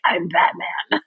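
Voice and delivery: Batman. Retold or imitated. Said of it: "And he's like".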